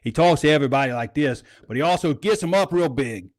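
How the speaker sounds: a hint of a Louisiana coach voice; Cramsey's Louisiana-ish talk. Shown as a demonstration. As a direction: lightly mimic the coach's Southern-sounding talk. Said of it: "He kinda talks like this".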